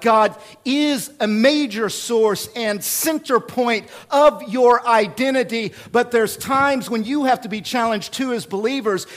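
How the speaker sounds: preaching. Real, not acted. Pastor challenging believers about security, preaching at full force.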